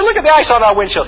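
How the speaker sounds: high, loud alarmed call. Retold or imitated. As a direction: point at the ice in alarm. Alarmed shout to copilot about ice.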